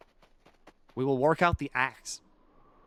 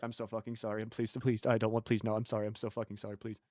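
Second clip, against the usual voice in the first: lower, softer, begging over and over; groveling apology; 7 semitones lower, 9 dB quieter, faster than usual. Retold or imitated. A character begs his boss not to cut him, lower and softer, groveling. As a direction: plead as if terrified to offend. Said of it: "He's being stupidly polite".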